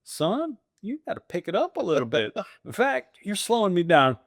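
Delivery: high, cocky mock advice; passing the struggling friend with teasing advice; mock condescension. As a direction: talk down to him for fun as you pass. Mocking rival while passing him.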